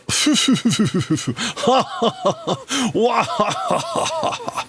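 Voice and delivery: a long high giggle breaking out; laughing at your own hammy reading; amused laugh. Real, not acted. Teacher laughing at her own character voice, high and long. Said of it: "Sorry, I'm gonna have too much fun".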